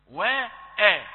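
وآ stretched long and loud; drawn-out wrong vowel. Shown as a demonstration. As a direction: exaggerate the error on وآ. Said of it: "لا، ما ينفعش". (مد خاطئ مفخم في وآ)

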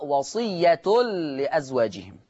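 وصية لأزواجهم slow, read wasiyyatul-li-azwajihim; damma on the taa. Shown as a demonstration. Teacher reading Warsh's variant, وصية with u on the taa. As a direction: end وصية on tun, merged into لأزواجهم. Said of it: "تقرأ بضم التاء".

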